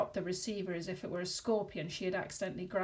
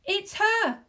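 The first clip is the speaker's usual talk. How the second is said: high, loud scream of recognition; 12 semitones higher, 10 dB louder than usual. A narrator's reading. Wife screaming at the ringing phone, a high shriek. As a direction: shriek it out in terror. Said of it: "she screamed".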